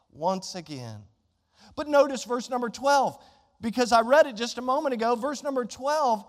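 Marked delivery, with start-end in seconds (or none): 0.1-1.0 weary sigh. Retold or imitated. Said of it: "God's like".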